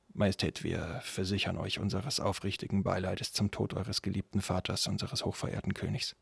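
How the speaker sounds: soft, subdued formal address; solemn condolence. Acted. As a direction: gently break the death to the new king. Steward offers condolences on death. (leise, feierliche Beileidsbekundung)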